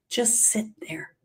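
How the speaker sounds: slow, soft whisper; hushed instruction to the listener. Shown as a demonstration. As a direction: whisper the advice slowly. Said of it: "advice whispered to listener".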